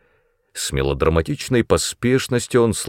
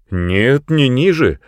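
raised-voice protest; louder, higher contradiction; cried out; 5 semitones higher, 4 dB louder, slower than usual. A narrator's reading. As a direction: cry out in objection, pitch jumping up. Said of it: "крикнул я".

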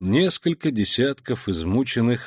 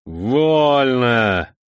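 louder scornful order; contemptuous command; 2 semitones higher, 5 dB louder than usual. Acted. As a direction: give the order with disdain. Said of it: "с нескрываемым презрением скомандовал".

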